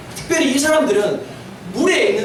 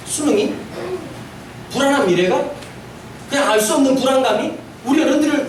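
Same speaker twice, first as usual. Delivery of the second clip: ordinary talk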